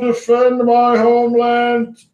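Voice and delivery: high, loud, drawn-out dragon boom. Acted. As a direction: boom it out, slowing at the end. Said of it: "the booming voice of the dragon".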